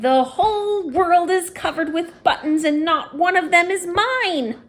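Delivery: high, loud, wild tantrum scream; furious scream. A narrator's reading. Toad screaming that no button is his, furious tantrum. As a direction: explode with rage. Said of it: "jumped up and down and screamed".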